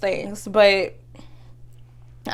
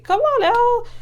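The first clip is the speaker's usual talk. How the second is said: exasperated appeal; 13 semitones higher than usual. Retold or imitated. Voices past frustration at rejection.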